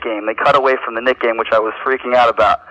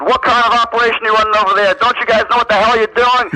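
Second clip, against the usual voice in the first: mock angry scream; 9 semitones higher than usual. Prank caller screaming at the network producer in mock anger. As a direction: chew out the producer like a furious boss. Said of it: "So I'm screaming at the guy".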